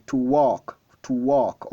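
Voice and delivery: "walk" said as "wok", no L sound; "walk" clearly pronounced "wok"; silent L in walk. Shown as a demonstration. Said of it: "Le L est silencieux".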